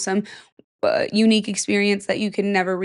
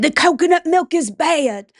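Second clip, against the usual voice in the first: high, louder mock line; quoting the show; 7 semitones higher, 5 dB louder than usual. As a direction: lightly act out the character's warning. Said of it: "he said".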